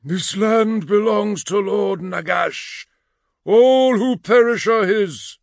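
high, loud, slow spectral voice; phantom proclamation. A narrator's reading. Phantom warns the blood slaves, loud spectral proclamation. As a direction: proclaim it slowly as a commanding ghost.

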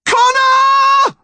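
very high, drawn-out yell. A narrator's reading. Mum slipping from Connor's hands, desperate yell. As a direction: scream the name in desperation. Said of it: "his mum yelled".

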